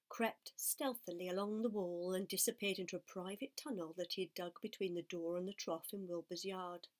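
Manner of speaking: ordinary delivery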